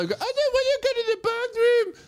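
put-on very high-pitched whining toddler; toddler tantrum. Patient treated like a toddler, bratty high whine. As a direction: wail it like a stubborn little kid. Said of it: "he's treating me like I'm a toddler".

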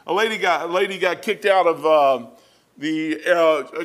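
ordinary delivery

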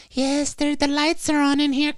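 high, light voice of a vault opening up; mock announcement. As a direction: squeak it out like a secret door swinging open.

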